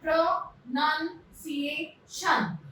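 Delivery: प्रोनाउंसिएशन broken into separate syllables with pauses. Shown as a demonstration. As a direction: say प्रोनाउंसिएशन slowly, pro-nun-ci-ation. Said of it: "sound से हम उन्हें break करेंगे".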